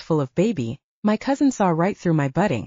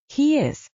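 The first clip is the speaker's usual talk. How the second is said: crowing; 8 semitones higher than usual. A narrator's reading. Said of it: "Camilla crowed".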